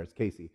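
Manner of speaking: CASEY with an S, low and soft; outsider's pronunciation. Retold or imitated. College guy repeating himself, Casey with an S. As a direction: say Casey softly as KAY-see. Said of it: "He said".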